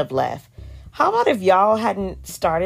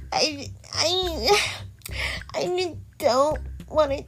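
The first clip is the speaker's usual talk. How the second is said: mock crying; 10 semitones higher, 4 dB quieter, slower than usual. Voicing Keely crying.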